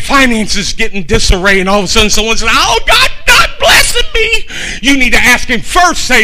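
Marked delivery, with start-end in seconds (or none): 2.5-4.5 high, crying shout, slowed; mock anguished cry. A believer crying out to God, high wailing shout. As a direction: wail it like a desperate believer.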